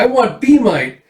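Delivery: put-on high, loud little-girl demand; girlish insistence. Erica insists on Be-Mite to the chef, high and loud. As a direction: squeak it out like a stubborn kid.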